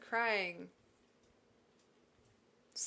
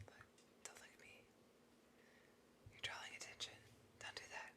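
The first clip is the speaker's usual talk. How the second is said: mock whisper; 20 dB quieter, faster than usual. Hushing someone pointing her out.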